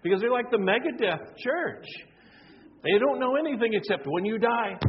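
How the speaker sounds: preacher mocking churches, loud and high; mocking emphasis. Real, not acted. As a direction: hammer home the churches' empty promise. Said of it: "sermon punchline mocking churches".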